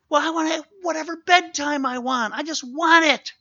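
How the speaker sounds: put-on high, loud whiny kid insisting; demanding child. Child insisting to parent on bedtime, loud whiny high voice. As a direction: stamp your foot like a spoiled child.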